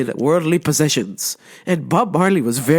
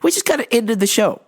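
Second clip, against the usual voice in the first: ordinary talk, nothing marked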